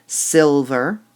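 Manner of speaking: "Silver" loud on first syllable; stress on silver. Shown as a demonstration. Teacher marks stressed syllables, hitting "Silver" loud. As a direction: punch "Silver". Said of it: "That's weak".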